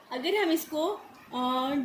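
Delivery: normal talking voice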